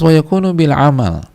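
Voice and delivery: high, loud proclamation; preacher declaiming the Arabic phrase; preaching call. Acted. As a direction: announce the point with force. Preacher calls out Arabic point.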